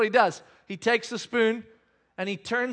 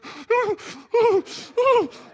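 high, loud stifled sobs; imitated crying behind a hand; muffled child sobbing; 7 dB louder than usual. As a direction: mimic a boy choking back wails. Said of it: "puts his hand over his mouth".

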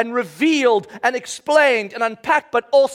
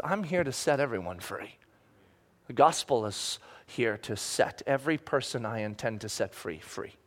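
much softer, fading to near silence; soft declaration; 12 semitones lower, 10 dB quieter than usual. Retold or imitated. Jesus telling the centurion's people everyone is free, hushed. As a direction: let the promise fall gently away to a hush.

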